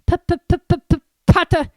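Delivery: high, nervous stutter; stammering. Acted. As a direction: stutter out of fear. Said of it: "stammered Professor Quirrell".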